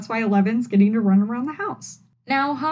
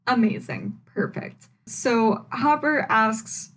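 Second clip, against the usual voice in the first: ordinary delivery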